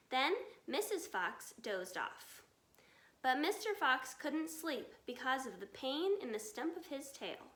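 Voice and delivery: ordinary delivery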